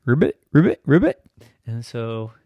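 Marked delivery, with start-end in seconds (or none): 0.1-1.2 croaky ribbit, rising; frog croak. Acted. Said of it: "you just start ribbiting".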